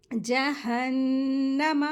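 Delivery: जहन्नम with a held ghunna on the doubled nun and a quick meem; slow recited जहन्नम, nasal nun held, meem short; Quranic recitation. Shown as a demonstration. Teacher demonstrates जहन्नम for the class. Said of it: "नून मुशद्दद है, गुन्ना करेंगे".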